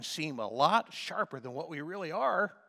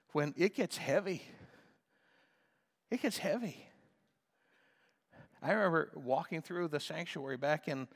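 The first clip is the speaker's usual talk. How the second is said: normal talking voice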